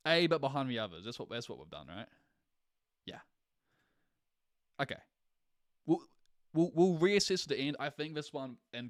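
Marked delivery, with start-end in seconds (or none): none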